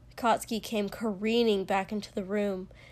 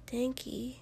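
soft, slightly raised concern; hushed; 4 semitones higher, 6 dB quieter than usual. A narrator's reading. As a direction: say the name carefully, hushed.

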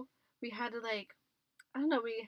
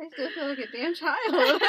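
laughing; 6 semitones higher, 11 dB louder than usual. Real, not acted. Laughing after shriek.

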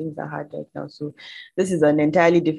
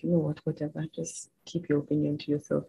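frustrated dismissal; 9 dB quieter than usual. Retold or imitated. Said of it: "I just get so frustrated".

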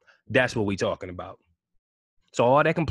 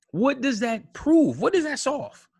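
exasperated; 13 semitones higher than usual. Real, not acted. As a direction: demand an answer, pitch climbing. Ranting at rioters.

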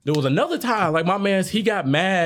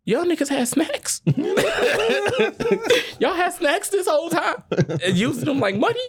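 high-pitched, laughing mock outrage at the snacks; amazed disbelief. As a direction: squeal it like you can't believe it. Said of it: "I'm sitting there like".

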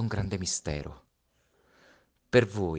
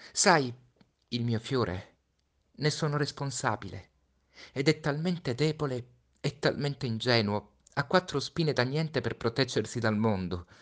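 put-on higher child's voice, fragile; a boy fretting over his flower; Little Prince, tender and worried; 7 semitones higher, 4 dB louder than usual. (Piccolo Principe, voce acuta e tenera)

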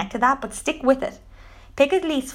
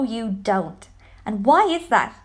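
usual speaking voice

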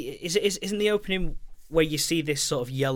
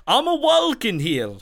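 high, slow, laughing New York voice; giggly exaggerated Noo Yawk; New York accent; 9 semitones higher, 3 dB louder, slower than usual. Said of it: "someone doing a New York accent".

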